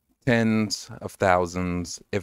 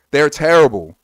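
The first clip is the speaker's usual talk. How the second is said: loud, high, fed-up outburst; exasperated shout; 8 semitones higher, 12 dB louder than usual. Real, not acted. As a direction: blurt it out in frustration. Exasperated rant about Linux.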